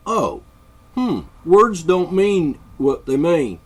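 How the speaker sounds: high, loud, slow sneering mimicry; mocking a moron. Sneering at people who argue words mean nothing, slow loud mockery. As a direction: drag it out like a dimwit. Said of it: "would actually say".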